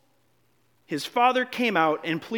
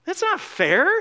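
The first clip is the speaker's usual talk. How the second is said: higher, louder whiny protest rising at the end; older brother protesting; 9 semitones higher, 3 dB louder, slower than usual. Acted. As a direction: burst out with the complaint. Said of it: "Maybe you relate with the older brother".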